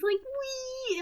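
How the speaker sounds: high-pitched giddy squeal; excited. Acted. Recapper acts out Joker excited on the talk show, giddy. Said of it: "he's so excited".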